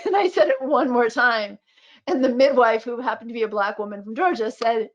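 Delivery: ordinary delivery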